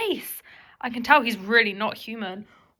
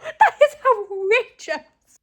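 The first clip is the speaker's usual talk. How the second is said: shocked laughing outrage; 12 semitones higher, 5 dB louder than usual. Real, not acted. Reacting to absurd text.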